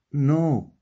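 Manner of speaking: firm loud refusal; insisting no. Acted. Monka refusing Okani, a loud firm no. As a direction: slam the no down hard. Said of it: "insisted Monka".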